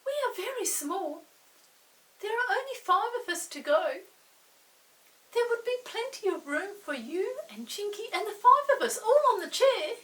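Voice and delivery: high, quick little earl voice. Acted. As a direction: pipe up like a tiny earl asking a favour. Earls explain they are small.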